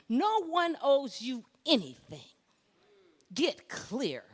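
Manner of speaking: loud, high and commanding; forceful divine rebuke. Retold or imitated. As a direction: set someone straight with full authority. God rebukes her, retold.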